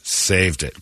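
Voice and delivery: ordinary delivery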